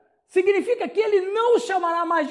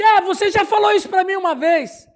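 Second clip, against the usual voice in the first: raised, higher-pitched mock accusation; acted accusation; 4 semitones higher, 6 dB louder than usual. [name] throws an old remark back in an argument, accusing.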